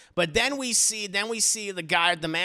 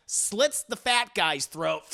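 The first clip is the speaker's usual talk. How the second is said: ordinary talk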